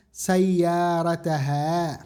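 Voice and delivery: sayyarataha said very slowly, every syllable drawn out; slow answer. Shown as a demonstration. Teacher to students, slowly gives the answer sayyarataha. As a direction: spell out sayyarataha for the class. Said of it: "atau sayyarataha?".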